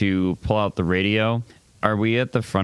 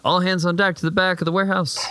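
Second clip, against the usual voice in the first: higher, fast barked radio order; guard on the radio; 10 semitones higher, faster than usual. Acted. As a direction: sound like one of the gang. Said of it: "try to sound like one of them".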